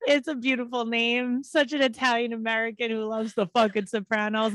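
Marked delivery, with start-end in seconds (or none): none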